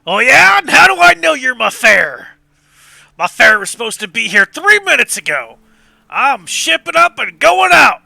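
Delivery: put-on loud, high, cantankerous old man; suspicious old boatman. Old boatman refusing late passengers, loud and cantankerous. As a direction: challenge them at full volume.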